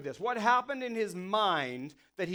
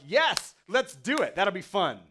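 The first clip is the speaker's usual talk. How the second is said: excited; 7 dB louder, slower than usual. Retold or imitated. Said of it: "And Jesus is like".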